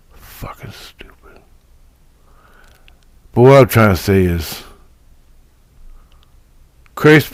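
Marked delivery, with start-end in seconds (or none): 0.1-1.4 whispered under the breath, fed up. Real, not acted. Fed-up vlogger whispering to himself about the grind. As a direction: hiss it to yourself, worn out. Muttering frustration to self.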